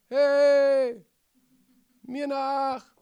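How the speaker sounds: loud, high call to the flock; shepherd calling. Retold or imitated. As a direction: call the sheep to follow. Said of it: "Die hören nur".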